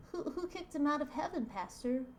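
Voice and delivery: put-on high, quick, stammering child; little kid voice. Boy questioning his pastor, little kid voice. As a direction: pitch it up like a curious small boy. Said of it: "the kid now is talking to the pastor".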